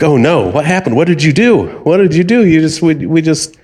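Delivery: high, panicked scammer act; mock freak-out. Scammer blames the old caller for the transfer, freaking out. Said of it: "then they start freaking out".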